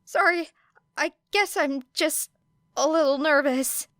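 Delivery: put-on high, sheepish nervous young man; apologetic and a bit embarrassed; high-voiced shy teen boy. A narrator's reading. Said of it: "with an anxious laugh".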